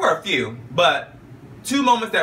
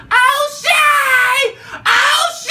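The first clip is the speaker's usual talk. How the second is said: very high, loud shrieking of a name; mock fan scream; 7 dB louder, much slower than usual. Mimicking the neighbour screaming for [name]. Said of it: "she was all about [name]".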